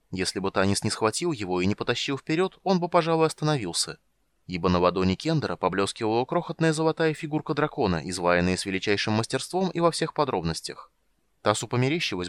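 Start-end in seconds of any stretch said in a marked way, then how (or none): none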